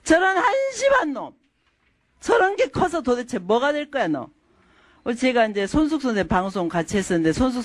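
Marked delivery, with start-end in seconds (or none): 0.1-4.3 higher-pitched, exasperated telling-off; scolding parent. Acted. Parent verbally abusing a child, contemptuous scolding. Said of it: "말로 한 학대가 그거 못지않게".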